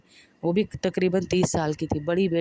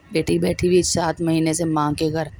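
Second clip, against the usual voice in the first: usual speaking voice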